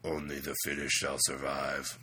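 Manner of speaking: low, slow villain pronouncement; Apocalypse voice. Pretend. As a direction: intone it slowly like a supervillain.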